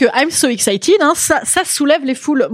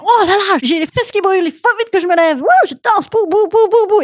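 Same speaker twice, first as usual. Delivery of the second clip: higher, hammed-up excited dancer; mock burning-bottom dancer; 8 semitones higher than usual. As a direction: jump up like your seat is burning. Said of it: "et là, tu fais".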